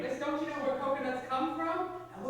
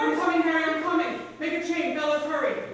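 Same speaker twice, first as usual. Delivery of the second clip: urgent shout; 7 semitones higher, 10 dB louder than usual. Acted. Rescuing brother from ice.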